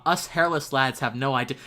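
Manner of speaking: normal talking voice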